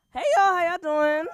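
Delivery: big, high hello to the room; loud crowd greeting. Real, not acted. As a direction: call out to the whole crowd.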